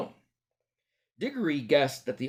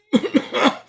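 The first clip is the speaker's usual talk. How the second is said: high choking cough; muffled cough; 7 dB louder than usual. Acted. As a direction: cough under a hat. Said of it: "from inside the hat".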